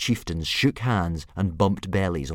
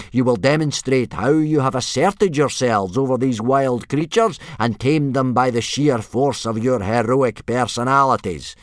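loud, raised parade-ground bellow; yelled orders; 8 semitones higher, 9 dB louder than usual. A narrator's reading. Instructor laying out the test to boys, yelling. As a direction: bark the rules at nervous recruits. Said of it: "yelled Gobber".

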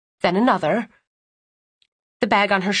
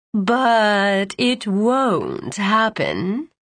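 slow, syrupy and menacing; sickly sweet; much slower than usual. A narrator's reading. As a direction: drip false sweetness slowly. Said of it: "said a sickly sweet voice".

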